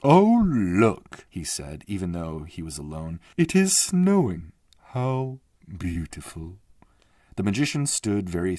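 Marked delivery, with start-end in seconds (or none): 0.0-1.3 lightly voiced magician, a little higher and louder; a hint of a magician marvelling at snow; magician, struck by wonder. 3.4-6.6 lightly voiced magician, a little higher and louder; a hint of a magician marvelling at snow; magician, struck by wonder.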